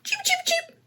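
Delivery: very high, soft cheeping; chick cheeps. Retold or imitated. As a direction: peep like a tiny chick. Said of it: "They say".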